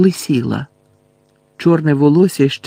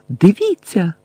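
higher-pitched horrified exclamation; вигук із жахом; 5 semitones higher, slower than usual. A narrator's reading. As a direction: cry out in horror, a touch contained. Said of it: "з жахом вигукнув Невіл". (наляканий вигук)

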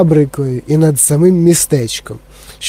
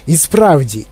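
usual speaking voice

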